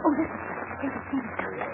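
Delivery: hushed, breathless whisper. Acted. Captive Cherry warns her rescuers of the guard, whispering. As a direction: warn them without a sound.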